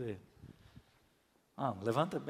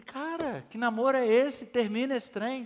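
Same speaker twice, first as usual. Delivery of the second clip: higher, incredulous rebuke; exasperated scolding; 7 semitones higher, 4 dB louder than usual. Retold or imitated. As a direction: shake him out of it. Pastor scolds indecisive friend. (bronca exasperada, mais aguda)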